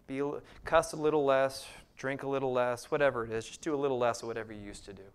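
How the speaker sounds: loud, sarcastic parody of easy religion; mocking. Preacher mocking cheap faith with sarcastic loud jabs.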